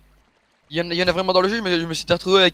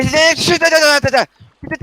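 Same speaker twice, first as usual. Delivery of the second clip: insistent interrupting; 6 semitones higher, 6 dB louder than usual. Real, not acted. Cuts off the other in debate.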